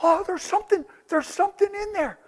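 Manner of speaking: lightly voiced scared villager; mock frightened villagers. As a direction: warn him of something uncanny. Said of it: "And they're going".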